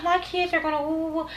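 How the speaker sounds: soft, high mocking singsong; mocking imitation. Mocking Ariane's claim.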